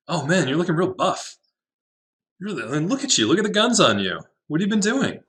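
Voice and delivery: high, gushing voice of an impressed friend; hammed-up high fawning over someone buff; mock admiring gym buddy. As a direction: play a friend marvelling at muscles. Admiring a buff imagined figure.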